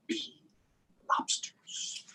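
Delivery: hushed, breathless whisper of lobsters; whispered refrain; spooky whisper. Acted. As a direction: lean in and whisper the warning.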